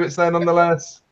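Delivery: ordinary talk, nothing marked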